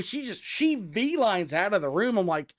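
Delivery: plain reading voice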